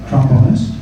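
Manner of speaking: ordinary delivery, nothing marked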